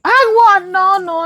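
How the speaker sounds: high-pitched urgent interruption; alarmed protest. Acted. Mother stops her daughter's despairing talk, alarmed. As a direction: jump in to stop the talk.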